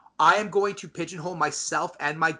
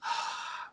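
slow audible breath out; demonstrative calming exhale; deep breath; 10 dB quieter than usual. Shown as a demonstration. Host showing listeners the debate reset, deep breath. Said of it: "we take a deep breath".

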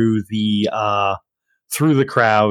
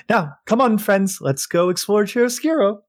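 cheerful call; 8 semitones higher than usual. Acted.

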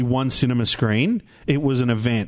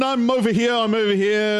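high-pitched usher announcing himself; mock noisy usher; 5 semitones higher than usual. Co-host playing a conspicuous cinema usher, high mock announcing. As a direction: make a comic show of your arrival. Plays an usher arriving noisily.

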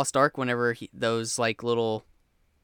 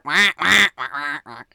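goose honk imitation; 5 dB louder than usual. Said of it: "And you hear".

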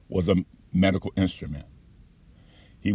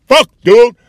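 much louder, high-pitched cry of protest; pained outburst; 13 semitones higher, 14 dB louder than usual. Retold or imitated. Patient retelling his protest to the doctor mid-procedure, loud outburst. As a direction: yell it out, retelling a sudden hurt.

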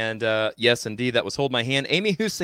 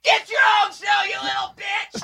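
mock squeaky shout. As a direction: yell it in a bratty squeal. Repeated mock retort.

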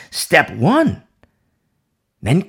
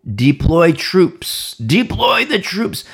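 slow, raised command voice; imitating a leader issuing orders; 4 semitones higher, 3 dB louder, much slower than usual. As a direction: deliver it as a drawn-out military order. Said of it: "Deborah then calls for Barak and instructs".

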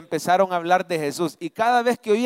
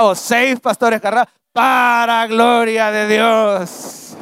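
preaching crescendo; 3 semitones higher, 7 dB louder, slower than usual. Acted. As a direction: rise to a shouted praise, slowing at the end. Preacher building to full force.

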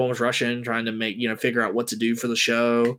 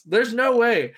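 normal talking voice

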